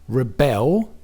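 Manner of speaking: Rebel with the stress on BEL, lifting at the end; weak first syllable, strong rising BEL in Rebel; stress on second syllable. Shown as a demonstration. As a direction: repeat the verb Rebel as reh-BEL, rising. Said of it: "the stress is on the bell".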